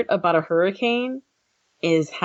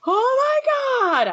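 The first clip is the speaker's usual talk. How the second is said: drawn-out, much higher mock shriek; slow hammed-up panic, much higher; mock freak-out; much slower than usual. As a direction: stretch it out like a panicking newcomer. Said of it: "I'd just be losing my shit".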